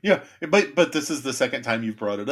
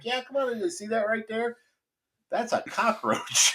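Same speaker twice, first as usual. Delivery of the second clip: put-on baby talk to a dog; 11 semitones higher than usual. Pest tech showing the dog a cockroach under the sink, high baby talk. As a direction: show the puppy the bug in a high sweet voice.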